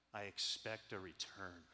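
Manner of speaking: low, soft, slow and weighty; solemn mentor. Retold or imitated. Mentor telling his student he expects a return, low and slow. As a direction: lay it down like a quiet charge.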